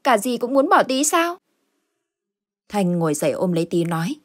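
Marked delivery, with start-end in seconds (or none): 0.0-1.4 put-on higher, pleading little child. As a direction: plead like a scared small child.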